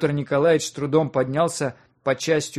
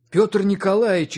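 mildly amazed, higher and louder; a touch of astonished recognition; 6 semitones higher, 5 dB louder than usual. A narrator's reading. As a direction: recognize someone with surprise. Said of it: "изумился он".

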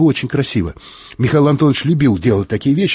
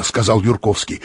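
ordinary delivery

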